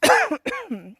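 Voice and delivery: coughing after swallowing a fly; just swallowed a bug. Real, not acted. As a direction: cough it out, then push the word. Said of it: "just swallowed a fly".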